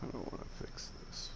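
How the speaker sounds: whispered aside to himself; under-the-breath mutter. Real, not acted. Muttering to himself while building.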